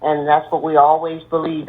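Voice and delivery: ordinary delivery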